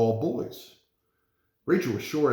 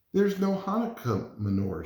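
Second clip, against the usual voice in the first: put-on girl; 8 semitones higher than usual. Girl points out problem.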